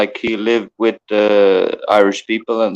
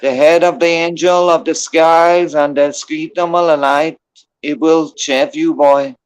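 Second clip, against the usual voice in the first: a mock-solemn Irish blessing, pitched a bit higher; put-on Irish accent; 4 semitones higher than usual. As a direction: intone it like a hammed-up Irish elder. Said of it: "I tried to make up a good accent".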